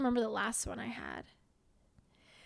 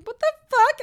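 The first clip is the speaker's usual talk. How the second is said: loud, higher, outraged "What the fuck?"; shocked outburst; 13 semitones higher, 16 dB louder than usual. Retold or imitated. Girlfriend reacting to her laughing boyfriend, loud shocked yell. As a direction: blurt it out in disbelief. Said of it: "And I was like".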